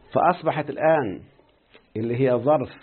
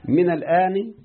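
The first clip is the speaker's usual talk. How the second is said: من الآن with من raised and louder; stressed recitation; 6 semitones higher, 4 dB louder, slower than usual. Shown as a demonstration. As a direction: lift من high and strong. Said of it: "سمعنا يا شيخنا؟". ("من الآن" بنبرة عالية على "من")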